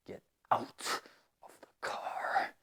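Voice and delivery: mock furious girlfriend hissing Get out of the car; a hint of a cold, quiet angry girlfriend; angry girlfriend, whispered. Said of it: "la novia es muy enojada".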